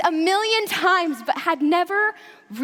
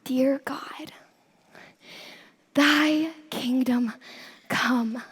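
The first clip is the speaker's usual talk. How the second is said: whispered prayer; 4 semitones lower, much slower than usual. Acted. Sister's whispered prayer remembered.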